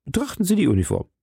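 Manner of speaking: high, rapid, emphatic appeal; urgent insistence. Acted. Landlord repeats his proof to commissioner, urgent and high.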